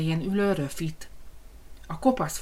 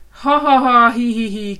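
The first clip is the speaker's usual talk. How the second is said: loud, higher mocking laugh; laughing pigs; 5 semitones higher, 10 dB louder than usual. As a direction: giggle at the wolf. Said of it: "kacagtak a malacok". (kacagó malacok)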